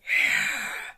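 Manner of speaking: loud unvoiced growl. Shown as a demonstration. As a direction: growl it out hard. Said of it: "which is kinda why I do".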